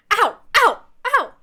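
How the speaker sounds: three sharp pained yelps; repeated "ow" of sudden pain. Acted. Player hopping after kicking the ball, yelping in pain. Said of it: "hopping around on one foot in pain".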